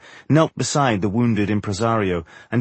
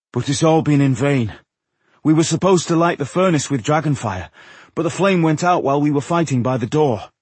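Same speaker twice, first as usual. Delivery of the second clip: a touch of dismay, higher voice; lightly voiced disheartened Tungdil; 5 semitones higher, 3 dB louder than usual. Dwarf admits a failed mission to the ancient host, mildly dismayed. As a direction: pitch it up a little in discouragement.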